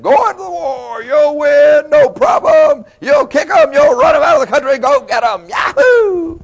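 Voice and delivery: high, loud mock cheerleading whoop; rowdy hype. Dancing prophets cheer the king into war, rowdy hype. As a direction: egg them on with hammed-up war whoops.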